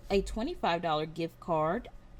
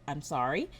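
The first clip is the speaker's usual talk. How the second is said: ordinary talk, nothing marked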